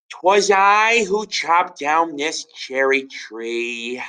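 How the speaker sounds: high, slow solemn confession; earnest boy. Acted. George confessing to his father, high and slow. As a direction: own up with noble gravity. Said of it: "answered George".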